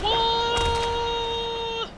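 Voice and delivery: long, very high "whoa"; drawn-out high cry of alarm; startled whoa. Real, not acted. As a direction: yelp in shock and hold it. Startled while playing the game.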